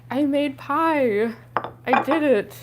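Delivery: slow, stretched proud announcement; proud drawn out. Real, not acted. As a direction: draw it out, delighted with yourself.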